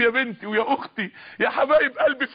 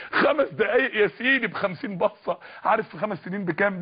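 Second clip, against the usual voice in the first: usual speaking voice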